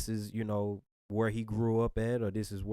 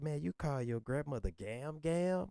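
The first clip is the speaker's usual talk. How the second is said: lightly imitated boss, higher and softer; mocking movie boss; 5 semitones higher, 5 dB quieter than usual. Boss ridiculing employee's name for grandma, imitated mockery. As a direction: tease like the boss, pitched up and soft. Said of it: "bro was talking to his boss".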